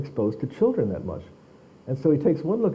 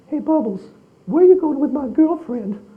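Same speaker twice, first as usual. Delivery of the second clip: much higher, airy falsetto impression of Michael; imitating [name]; 13 semitones higher, 6 dB louder than usual. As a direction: play it as Michael's high, playful voice teasing the chimp. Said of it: "he goes".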